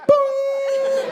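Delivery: high, held "boooom"; sustained sound-effect boom; imitated boom. Comic after seeing lightning out the window, high imitated boom. Imitates thunder or scare outside.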